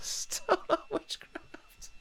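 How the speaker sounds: breathless, whispered words through laughter, very slow; can barely get it out for giggling; laughing. Real, not acted. Cracking up at the stolen witchcraft claim, laughing through it.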